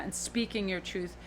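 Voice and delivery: usual speaking voice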